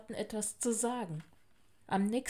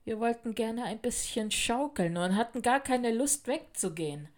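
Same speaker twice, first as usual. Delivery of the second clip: plain reading voice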